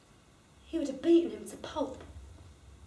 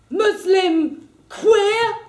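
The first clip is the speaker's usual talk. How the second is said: slow, much higher and louder sneer; slow, loud, jeering insult; sneering thug; 7 semitones higher, 8 dB louder, much slower than usual. Acted. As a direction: drag out the insult mockingly. Thug voiced in story.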